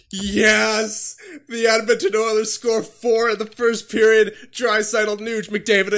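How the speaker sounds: high, loud jubilant announcing; excited celebration. Real, not acted. Fan celebrates Edmonton's four-goal first period, elated shout.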